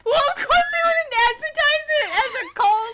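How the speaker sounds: fast, high-pitched shouted retort; exasperated outburst. Pretend. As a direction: fire it back loudly, as if it's obvious. Mock-exasperated retort to co-host.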